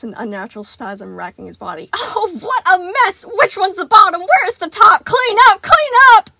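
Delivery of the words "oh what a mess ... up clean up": very high, loud, shrieking orders; a compulsive robot melting down; frantic panic. A narrator's reading. Sun shrieking about the mess, high and frantic.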